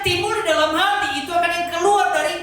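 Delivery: loud, high, insistent sermon climax; full-force preaching. Acted. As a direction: hammer each hancur home to the congregation. (khotbah berapi-api dengan suara keras)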